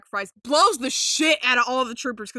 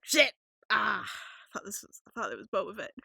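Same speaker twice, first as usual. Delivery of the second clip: mock disappointment; 4 dB quieter than usual. Acting out fans' letdown.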